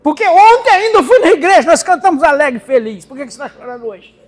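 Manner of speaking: loud, high, driving preaching; fervent preaching. Acted. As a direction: preach with rising fire. Preaching at full force.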